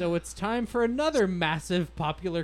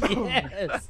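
reading through real laughter, high and loud; helpless giggles breaking into the reading; laughing hard; 9 semitones higher, 8 dB louder than usual. Real, not acted. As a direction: crack up until the words squeal. Reader cracks up at a comment.

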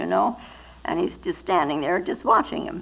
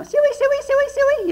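much higher, sing-song call to the pigs; hog calling; 4 dB louder, slower than usual. Retold or imitated. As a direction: call the hogs to their feed across the yard. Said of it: "I used to call them to their feed".

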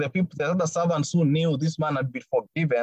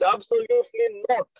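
much higher, firm voicing of Jesus; Jesus' reply. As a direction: answer as Jesus would, with a firm no. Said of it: "Jesus said".

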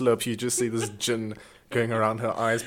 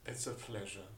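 hushed servant reply; 15 dB quieter than usual. Pretend. Playing royal servant.

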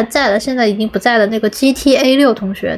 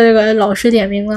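normal talking voice

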